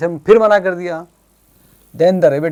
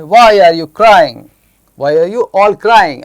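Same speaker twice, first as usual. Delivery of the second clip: lightly voiced fox, loud, slow and raised; fox's question; 3 semitones higher, 7 dB louder, much slower than usual. Fox asks the crying group why, in a loud storybook voice. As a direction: ask it slowly and loudly, as storybook fox. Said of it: "fox क्या कहती है".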